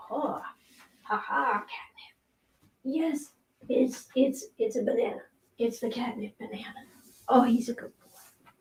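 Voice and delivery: soft whispering with a little laugh; whispered pet talk. Real, not acted. Owner whispers playfully as her cat gets catnip.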